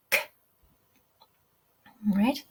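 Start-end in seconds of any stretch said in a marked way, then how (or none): none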